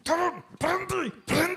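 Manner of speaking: a mock angry driver yelling out the window. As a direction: driver shouting at the preacher to stop. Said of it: "supirnya marah-marah".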